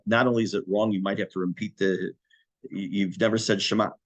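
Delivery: usual speaking voice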